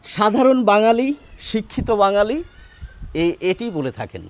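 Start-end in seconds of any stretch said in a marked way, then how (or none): none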